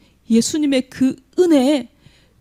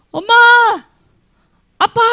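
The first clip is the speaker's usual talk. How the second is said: put-on child calling; 11 semitones higher, 6 dB louder, faster than usual. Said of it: "누구 찾아요?".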